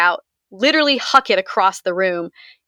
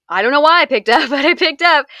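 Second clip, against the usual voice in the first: laughing nervously; 7 semitones higher, 5 dB louder than usual. Real, not acted. Nervous laugh recalling the call.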